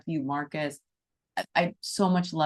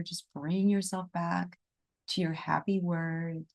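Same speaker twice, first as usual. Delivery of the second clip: guided meditation; 5 dB quieter than usual. Acted. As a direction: breathe them into the heart, gently.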